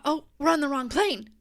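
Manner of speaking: retold alarm. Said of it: "And I thought".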